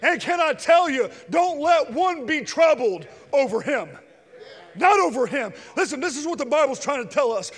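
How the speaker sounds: loud, high, building preacher's cry; climbing revival preaching voice; fiery preaching. Real, not acted. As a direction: preach it out at full force. Preaching at full force.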